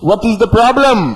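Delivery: drawn-out puzzled teacher. Retold or imitated. Teacher asks student what the problem is, slow puzzled.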